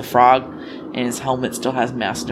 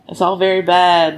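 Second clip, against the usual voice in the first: usual speaking voice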